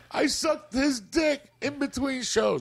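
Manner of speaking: put-on high, slow drunken yell of the wife; drunk woman yelling. Drunk wife yelling her confession at the club. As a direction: shriek it out like a hammered woman. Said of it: "this bitch yells".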